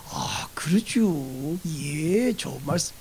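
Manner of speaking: soft, drawn-out polite yes; mild, unhurried mock agreement; agreeable Chungcheong person. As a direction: play someone who never refuses, slow and hushed. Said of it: "성격상 노라고 안 한다는 거예요".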